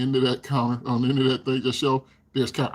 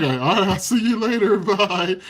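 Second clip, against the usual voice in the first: laughing goodbye; 7 semitones higher, 5 dB louder than usual. Retold or imitated.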